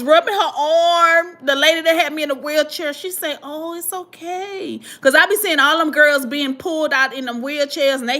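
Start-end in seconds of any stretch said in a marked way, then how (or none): 3.4-4.8 soft, slow, high reassurance; soothing attendant. Retold or imitated. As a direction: comfort a crying patient gently, voiced in retelling. Wheelchair attendant comforting her.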